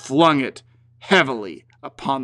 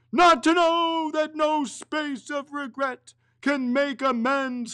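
high, anguished ghostly lament; a tormented ghost mourning; 10 semitones higher than usual. Acted.

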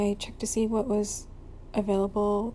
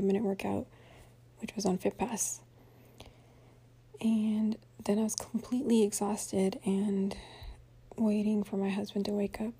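whispering. Real, not acted. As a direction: whisper it, half asleep.

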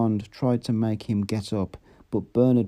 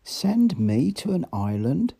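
higher and slow, rising in panic; a stunned, frightened echo of the threat; alarmed disbelief; 7 semitones higher than usual. A narrator's reading. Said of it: "galvanized Bernard into a violent and unseemly".

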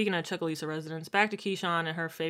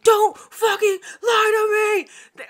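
much higher, louder, slow hammed-up yell; mock furious shout; 9 dB louder, much slower than usual. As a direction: blow up at her in a put-on rage. Voicing Derek's angry outburst.

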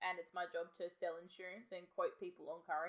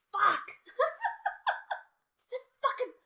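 loud high-pitched cursing outburst; frustrated yell; 14 dB louder, slower than usual. Real, not acted. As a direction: blurt the curse in frustration. Frustrated outburst over number.

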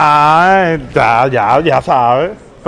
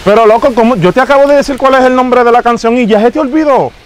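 louder, pitch pushed up, fake outrage at the fan; mock exasperation; 5 semitones higher, 5 dB louder than usual. Fake star scolds a fan who forgot his song, in mock outrage. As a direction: scold him for forgetting the song, hammed up.

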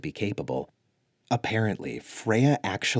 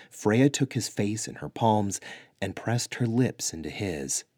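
ordinary delivery

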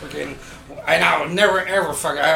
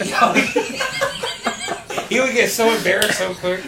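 chuckling through the words; real laughter while telling it; laughing; 5 semitones higher than usual. Real, not acted. Laughing about embarrassed friend.

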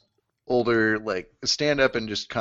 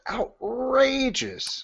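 outraged; 14 semitones higher, much slower than usual. Real, not acted. Complaining about service fee.

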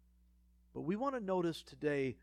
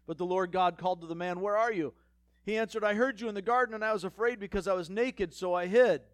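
louder, fast, urgent reading; dramatic reading; 4 semitones higher, 7 dB louder, faster than usual. Acted. Preacher reading God calling and Adam's fearful answer, loud and fast. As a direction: push it fast and loud as the tension builds.

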